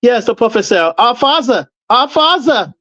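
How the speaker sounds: mock German accent, preacher-like; hammed-up German orator hailing the father. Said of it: "Why the German accent".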